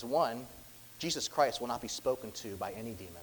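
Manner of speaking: usual speaking voice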